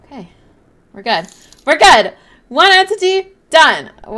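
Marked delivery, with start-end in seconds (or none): 1.6-3.9 louder and higher, victorious; loud relieved victory call; triumphant cheer. Real, not acted. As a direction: shout the win. Cheering after surviving entity.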